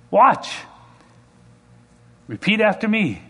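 high-pitched, showy command to the crowd; re-enacted preacher's confident demonstration; preacher addressing crowd. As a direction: call the crowd to witness. Acted showman preacher addressing crowd.

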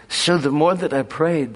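ordinary delivery

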